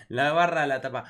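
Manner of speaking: normal talking voice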